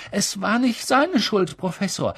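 put-on high-pitched child's protest; pleading pupil. A narrator's reading. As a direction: plead for your friend, falling away at the end. Pupil defends Harry to professor. (hohe, bittende Schülerstimme)

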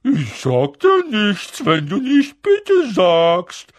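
high, loud, slow taunting lilt; annoying singsong. A narrator's reading. Peeves refusing to answer Filch, annoying singsong. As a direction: chant it teasingly, dragging the words. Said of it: "antwortete Peeves mit einer nervigen Singsangsstimme".

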